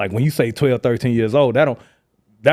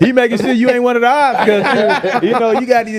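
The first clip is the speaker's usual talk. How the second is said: laughing; 9 semitones higher, 5 dB louder, faster than usual. Real, not acted. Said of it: "That's what I mean".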